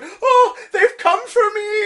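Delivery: high, loud frightened wail, half laughing; old woman panicking that Satan has come for her; terrified shriek. Retold or imitated. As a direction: shriek as if the devil's at the door. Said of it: "She's like".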